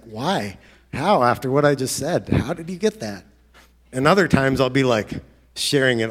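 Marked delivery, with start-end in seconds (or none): 0.0-3.2 high, loud disbelieving why and how; astonished. Retold or imitated. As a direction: ask it like you can't believe it. His own astonished reaction.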